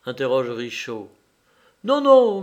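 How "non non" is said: high, loud quick no, no; excited high-pitched no; eager denial. A narrator's reading. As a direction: brush the guess aside excitedly. Countess eagerly correcting Richaud.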